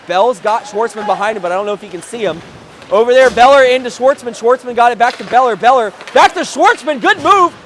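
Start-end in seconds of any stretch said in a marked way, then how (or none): 2.9-7.5 rising, louder excitement; building hockey commentary; excited play-by-play. Real, not acted. Exciting play near the net.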